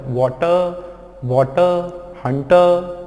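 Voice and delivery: वाटर, हंटर ending in open "tuh"; silent R. Shown as a demonstration. Teacher drills the right ending, silent R. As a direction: drop the final र in वाटर and हंटर. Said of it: "वाटर होता है".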